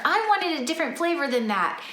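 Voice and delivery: mock a picky kid; a hint of a whiny child; imitating a child. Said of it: "that child says".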